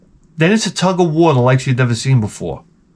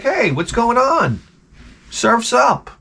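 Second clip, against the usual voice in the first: higher-pitched, carefree mock dog; innocent dog voice; 7 semitones higher, slower than usual. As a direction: play the dog acting like nothing happened. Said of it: "like, normal dog again".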